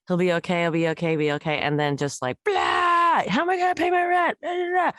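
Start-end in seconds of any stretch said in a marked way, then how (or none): 2.4-4.9 hammed-up teenage meltdown, pitched way up; imitating her son's panicked rant; whiny teen outburst.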